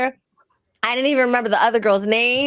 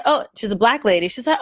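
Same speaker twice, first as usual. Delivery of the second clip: ordinary talk, nothing marked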